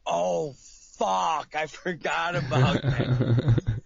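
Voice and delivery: mock realization. Man hearing voicemail, realizing he forgot the meeting. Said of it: "I'm like".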